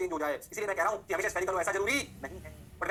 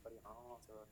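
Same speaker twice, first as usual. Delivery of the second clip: very soft, low student voice; student catching on; 9 semitones lower, 24 dB quieter, much slower than usual. Acted. Student realising the teacher is right, hushed low murmur.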